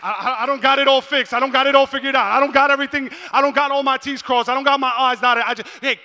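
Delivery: high, very loud, urgent exhortation; shouted sermon, pitched high and driving; full-force preaching. Real, not acted. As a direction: preach it at the top of your voice.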